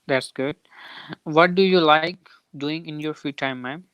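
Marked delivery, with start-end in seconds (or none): none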